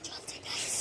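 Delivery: breathy whisper, no voice at all. Real, not acted. Child whispers to viewers beside the neighbours' house, a soft whisper. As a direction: hush it so the neighbours won't hear.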